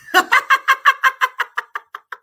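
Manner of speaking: loud, high shriek of laughter. Real, not acted. Host laughing hard at Nora's remark, high and loud. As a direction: howl with laughter.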